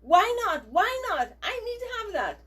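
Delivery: put-on high, loud demanding child; imitating a child's high whining protest; whiny child. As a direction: mimic a child who wants something. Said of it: "Your first reaction will be".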